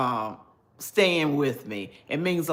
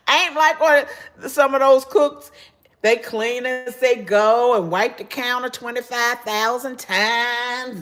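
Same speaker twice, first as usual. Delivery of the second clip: loud and emphatic, higher; 7 semitones higher, 6 dB louder than usual. Real, not acted. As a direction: declare it loudly. Emphatic rant about cooks.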